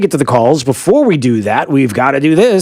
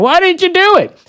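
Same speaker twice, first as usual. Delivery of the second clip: retold screaming. Listeners demanding the missed quiz, high mock yell. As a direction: squeak it like an annoyed listener.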